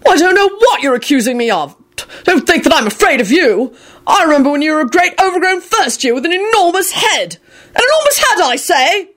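high-pitched blustering outrage; furious bellow. A narrator's reading. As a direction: rant indignantly, pitch climbing high. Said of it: "Arthur bellowed furiously at Kingsley".

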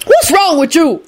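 high, sharp rebuff; scornful high-pitched challenge; rude. A narrator's reading. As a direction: snap it rudely in a high voice. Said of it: "Lucretia told Kenny rudely".

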